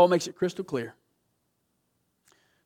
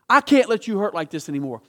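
loud, higher fired-up sermon voice; preaching at full force; 5 semitones higher, 8 dB louder than usual. Acted. Pastor urging his church, loud and high preaching. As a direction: preach it hard to the room.

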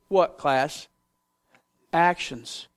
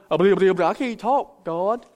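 mock stammer. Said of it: "He went from".

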